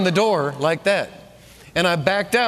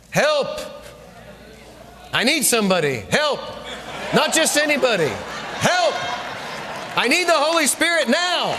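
rising pleading call for help; crying out for help; 5 semitones higher than usual. Acted. Preacher crying out to the Lord for help, building to a shout.

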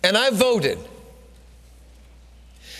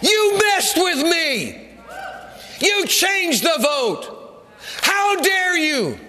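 slow, high accusing shout; shouting ballots; 8 semitones higher, slower than usual. Acted. Preacher voicing the ballots, slow high shout. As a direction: cry out as the wronged ballots. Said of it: "These ballots are shouting".

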